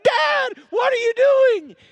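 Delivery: put-on very high, loud little-boy shout; frantic acted child's protest; acted panicked yell. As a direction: shriek it at dad in mock alarm. Panicked boy yells at dad.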